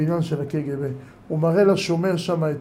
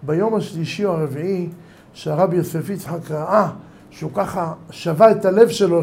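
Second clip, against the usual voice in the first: ordinary delivery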